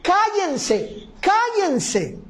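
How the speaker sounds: high-pitched acted scolding; hushing rebuke. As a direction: hush them, pitched well up. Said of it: "la gente comenzó a decirles".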